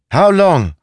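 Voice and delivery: high, loud repeated question; insistent demand. Acted. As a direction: press hard for an answer. Vambra repeats her demand.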